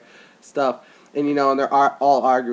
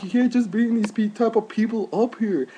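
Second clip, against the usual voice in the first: mock yelling girl; 10 semitones higher than usual. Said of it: "they're, like, yelling".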